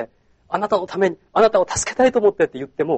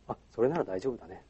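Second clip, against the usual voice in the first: deep, soft low voice; 6 semitones lower, 13 dB quieter than usual. Retold or imitated. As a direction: drop the voice down low. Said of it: "低い声で". (低く落とした声)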